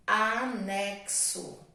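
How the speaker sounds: anexo drawn out slowly for the class; slow dictation. Shown as a demonstration. Teacher reading anexo slowly to children taking dictation. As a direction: stretch anexo out syllable by syllable. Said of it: "dictation, slowed word".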